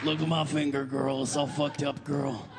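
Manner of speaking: low camp complaint. Retold or imitated. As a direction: grumble about the finger.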